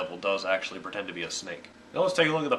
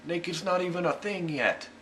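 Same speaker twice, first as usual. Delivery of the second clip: lightly voiced God, higher and mock-puzzled; God's voice; 9 semitones higher than usual. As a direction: play God as baffled by the word naked. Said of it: "And he's like".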